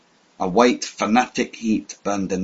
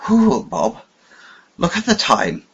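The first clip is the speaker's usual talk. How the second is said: put-on old man's voice; 9 semitones higher than usual. A narrator's reading. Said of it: "said the old man".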